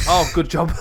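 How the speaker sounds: big laugh through the words; laughing hard at the phone. Real, not acted. Host laughs loudly at the phone answering.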